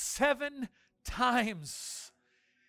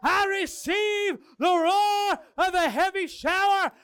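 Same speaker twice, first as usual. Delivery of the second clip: loud high shout; shouted declaration again; 11 semitones higher, 10 dB louder than usual. Real, not acted. Leading the congregation, loud declaration. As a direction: cry out the declaration. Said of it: "begin to declare".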